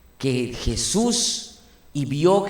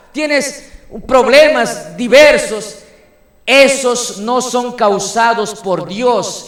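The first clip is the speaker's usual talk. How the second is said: loud, raised sermon voice pressing each point; full-force preaching; 6 semitones higher, 8 dB louder than usual. Acted. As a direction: preach it out to the congregation at full power.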